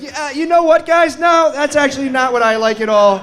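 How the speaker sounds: high, strained backpedal; panicked realization. Retold or imitated. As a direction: squeak out a too-late change of heart. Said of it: "you're like".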